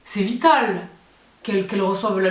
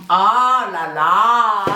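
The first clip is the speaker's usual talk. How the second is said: delighted laughing; giddy over the new gadget; excited laugh; 4 dB louder, much slower than usual. Real, not acted. Unboxing the vacuum, thrilled. (rire excité)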